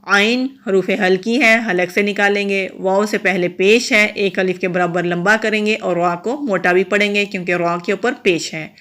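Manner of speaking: ordinary talk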